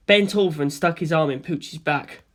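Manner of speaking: usual speaking voice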